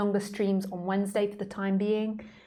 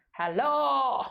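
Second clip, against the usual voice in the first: high, choppy voice breaking up like a bad call; Skype cutting out; 6 semitones higher, slower than usual. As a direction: stutter the sounds as if the connection drops. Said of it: "my impression of Skype cutting out".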